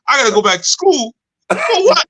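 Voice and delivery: climbing to a squeaky outraged For what; hammed-up disbelief, voice jumping high; mock indignant student. New member protesting to the teacher, high squeaky mock outrage.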